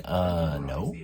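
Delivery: low, soft retold "no", sinking away; hesitant denial. Guest answering the staffer about someone else, hesitant and quiet. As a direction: imitate an awkward, hushed reply.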